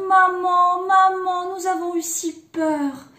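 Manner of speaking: high, loud, sustained wail to mother; kids crying out. A narrator's reading. Rescued kids running to mother, high relieved cry. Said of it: "s'écrièrent".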